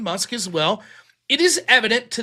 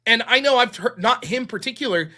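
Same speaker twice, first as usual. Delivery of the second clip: normal talking voice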